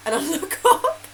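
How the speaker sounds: laughing through the words. Real, not acted. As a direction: giggle while telling it. Laughing while telling.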